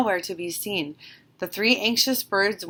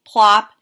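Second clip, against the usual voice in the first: loud plop; 11 dB louder than usual. A narrator's reading. Sound effect in story.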